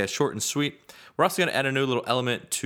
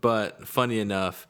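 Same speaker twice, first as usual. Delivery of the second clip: ordinary talk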